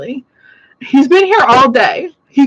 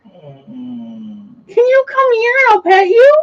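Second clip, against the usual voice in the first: higher, slow coaxing pet voice; coaxing the dog; 8 semitones higher, slower than usual. Real, not acted. Calling Remy over to be petted, high slow coax.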